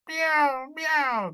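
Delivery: high mewing meow; cat meow. Acted. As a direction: mew like a cat. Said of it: "cried the cat".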